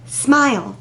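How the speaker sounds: normal talking voice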